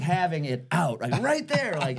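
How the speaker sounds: normal talking voice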